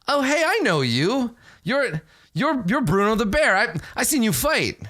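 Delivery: high-pitched starstruck recognition; excited fan. Acted. Game master as fan recognising Bruno the Bear, excited. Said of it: "he's very excited that he knows you".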